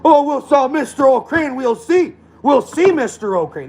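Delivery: high, slow taunting cop voice; mock smug cop. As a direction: drag out the threat like a smug cop. Retold smug cop threatening him.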